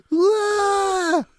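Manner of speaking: put-on shrill childish shriek; high-pitched scream. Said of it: "in the eleven-year-old high-pitched bitch voice".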